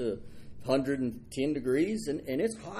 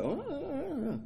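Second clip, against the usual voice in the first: high, soft mock whine of self-pity; mock whimper; 11 semitones higher, 6 dB quieter than usual. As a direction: whimper like a sulking child. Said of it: "You're like".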